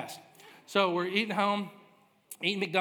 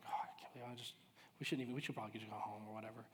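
low, much softer muttered complaint; retold grumbling; 7 semitones lower, 14 dB quieter, faster than usual. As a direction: grumble it under your breath. Retelling his grumbling while running late.

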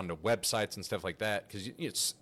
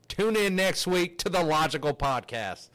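podcast outro; 7 semitones higher, 6 dB louder than usual. Acted. Show sign-off.

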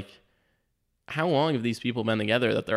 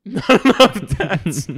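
slow, louder echo through laughter; laughing disbelief; 10 semitones higher, 9 dB louder, slower than usual. Real, not acted. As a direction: repeat it, cracking up in disbelief.

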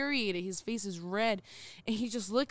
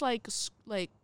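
ordinary delivery

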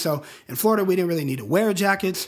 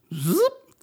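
ordinary talk, nothing marked

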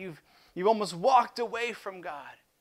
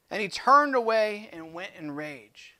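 ordinary delivery

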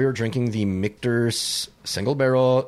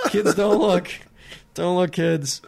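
mock warning to kids; 6 semitones higher than usual. Clerk telling nearby kids not to look, mock alarm. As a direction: warn the kids off, half-joking.

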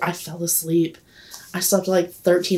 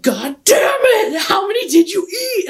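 exasperated brother; 11 semitones higher than usual. Retold or imitated. Brother scolding sister over edibles, high exasperated cry. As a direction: imitate a fed-up brother telling you off.

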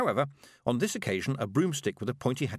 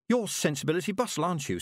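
put-on lightly higher girl's voice; a young girl voiced higher than the narration; 8 semitones higher than usual. A narrator's reading. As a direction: voice the young girl, bright and sure. Tiffany's voice in story.

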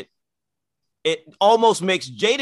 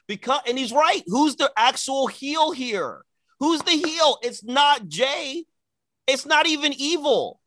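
rising rant; 8 semitones higher than usual. Real, not acted. Fan ranting to his co-host, building excitedly. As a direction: pitch climbing higher with each question.

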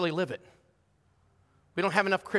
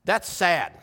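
normal talking voice

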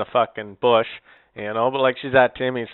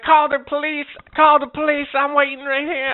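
put-on high, insistent old-woman voice; mocking, high-pitched demand; demanding old lady; 13 semitones higher, 3 dB louder than usual. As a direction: imitate a stubborn customer demanding action. Imitated old lady demanding police.

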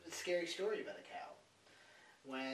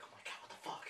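hushed, breathy whisper of panic; frightened whisper; 12 dB quieter, faster than usual. Retold or imitated. Friends behind him in the dark field, panicked whisper. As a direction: whisper it like you are hiding in the dark. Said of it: "they're like".

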